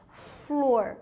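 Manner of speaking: "Flor" as one unbroken syllable; said in one breath. Shown as a demonstration. Teacher showing that flor cannot be split, one-breath word. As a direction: say "Flor" whole, in a single breath. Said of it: "a pronuncia num fôlego só".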